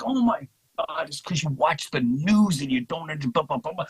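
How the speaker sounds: acted-out squabble, lightly heated; mock exasperated arguing. Acts out own side of argument.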